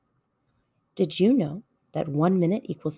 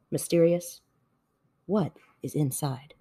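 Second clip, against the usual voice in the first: ordinary delivery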